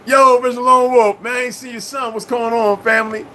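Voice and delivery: high, loud hype shout-out; high-pitched hey to a friend; excited greeting. Real, not acted. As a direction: call out to a viewer, fired up. Greeting a chat viewer.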